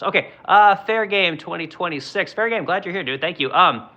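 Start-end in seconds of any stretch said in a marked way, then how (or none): none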